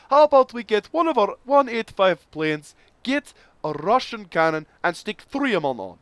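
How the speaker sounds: high, loud hammed-up Russian accent; terrible Russian accent. A Soviet engineer pitching his plan, in a hammed-up Russian accent. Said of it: "Forgive the terrible Russian accent".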